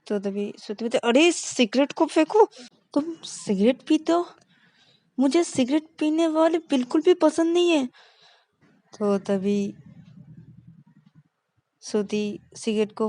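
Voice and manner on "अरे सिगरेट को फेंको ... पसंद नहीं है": high, loud disapproving outburst; shocked scolding. Acted. Girlfriend scolding her partner for smoking.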